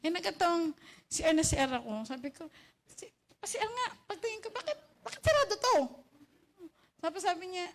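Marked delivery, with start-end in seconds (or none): 2.8-5.8 retold surprise. Retold scene at locked CR.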